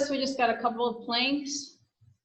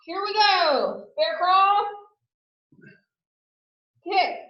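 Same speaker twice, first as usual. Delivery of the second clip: coach callout; 4 semitones higher, 4 dB louder, slower than usual. Real, not acted. Fitness coach cueing exercise.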